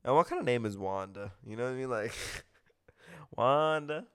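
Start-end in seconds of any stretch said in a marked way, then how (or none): none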